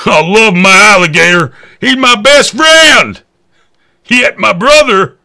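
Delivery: high, loud, doting put-on voice; loud, high mocking voice of a croc lover; mock pet owner. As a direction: gush like a clueless alligator fan.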